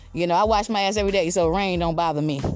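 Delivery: normal talking voice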